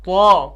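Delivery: طا loud, heavy and rounded, toward "taw"; thick, full-mouthed طا; correct thick pronunciation. Shown as a demonstration. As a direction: model an emphatic طا. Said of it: "صحیح تلفظ کیا ہے اس کا".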